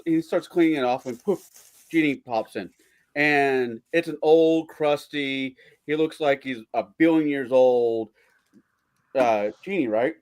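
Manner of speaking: ordinary delivery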